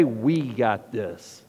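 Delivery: low, slow self-assured swagger; smug, self-satisfied drop in pitch; arrogant. Acted. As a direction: say it cocksure, low and unhurried. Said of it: "do we arrogantly say".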